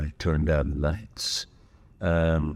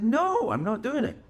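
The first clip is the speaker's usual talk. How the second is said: mock squealed refusal, very high; high-pitched refusal. Store owner refusing to brand a customer, comic high-pitched refusal.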